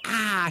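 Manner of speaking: "Ah" as an open vowel, R dropped. Retold or imitated. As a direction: echo the teacher with a high "Ah". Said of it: "they were just making her noise".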